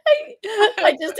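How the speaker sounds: breaking into giggles mid-sentence; laughing too hard to get the words out. Real, not acted. Laughing at herself while telling her friend about the skate park.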